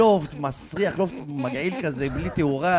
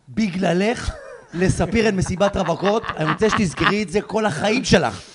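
loud, raised-pitch mock accusation; retold scolding; 3 semitones higher, 7 dB louder than usual. Storyteller blaming the bride's aunt, loud accusing. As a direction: lay the blame emphatically, loud. Retelling his blame of the aunt.

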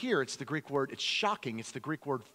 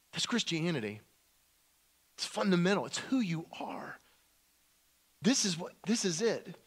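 ordinary talk, nothing marked

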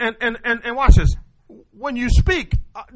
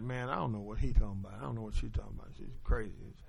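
muttered, much softer, much lower inner voice; mock the listener's private grumbling under the breath; secret inner thought; 10 semitones lower, 18 dB quieter, faster than usual. Listener muttering his doubts about the preacher, very low and soft. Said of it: "this secret thing in their mind like".

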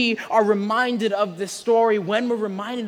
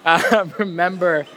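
laughing; 4 dB louder than usual. Real, not acted. Laughs at own joke.

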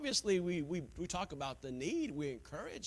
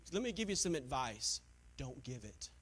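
ordinary talk, nothing marked

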